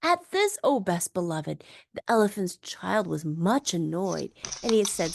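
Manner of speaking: ordinary delivery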